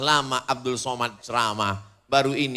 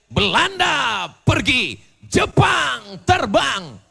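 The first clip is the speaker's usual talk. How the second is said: high, loud, drawn-out proclamation; rousing rally cry from the pulpit; oratorical shout; 13 semitones higher, 8 dB louder, much slower than usual. Real, not acted. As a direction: shout each short slogan to the crowd. Oratorical shouted climax of sermon.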